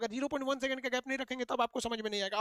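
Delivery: rattled off fast; fast talking. Shown as a demonstration. Teacher speeding up to show fast speech still understood. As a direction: rush through the sentence. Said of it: "इतना fast बोल रहे हैं".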